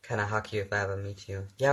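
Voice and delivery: ordinary delivery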